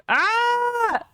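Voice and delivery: sudden high frustrated yelp; short sharp cry of annoyance; startled yelp. Real, not acted. Fed up with a confusing website, a sudden frustrated yelp.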